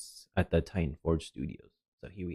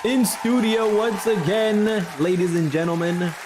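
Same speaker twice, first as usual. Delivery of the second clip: announcer-style welcome; 8 semitones higher than usual. Acted. Host welcoming viewers back in studio, announcer style.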